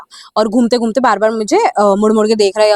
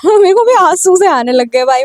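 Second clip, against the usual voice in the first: laughing through it; 7 semitones higher, 3 dB louder than usual. Real, not acted. Laughing while recalling her tears.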